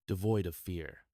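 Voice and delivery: plain reading voice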